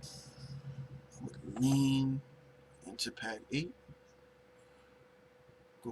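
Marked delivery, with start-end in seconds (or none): 0.0-2.2 demonstrating the move, drag out lean slowly; drawn-out lean. Shown as a demonstration. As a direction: lean stretched very slow, the word lean pulled long. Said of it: "if I were to lean into these pads".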